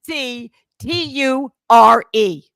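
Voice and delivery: slow letter-by-letter spelling; slow spelled picture; spelled out slowly. Shown as a demonstration. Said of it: "please say".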